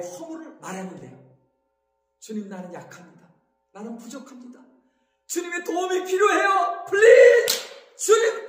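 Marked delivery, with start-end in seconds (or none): 2.2-4.6 low, soft confession to God, acted out; humble prayer. 본보기로 낮게 드리는 고백 기도. 5.3-8.5 acted plea rising to a high, loud cry; pleading prayer. 도움을 구하는 기도 시연.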